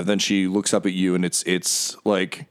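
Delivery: ordinary talk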